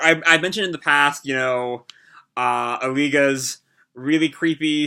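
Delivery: ordinary talk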